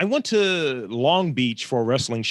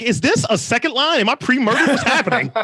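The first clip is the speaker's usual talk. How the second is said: mock bewildered panic; 11 semitones higher than usual. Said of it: "I was like".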